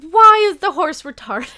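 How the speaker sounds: exasperated shout breaking into laughter; incredulous yell. Real, not acted. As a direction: yell it in amused disbelief. Ranting at the cartoon horse.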